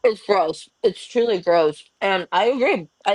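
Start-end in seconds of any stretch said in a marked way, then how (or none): none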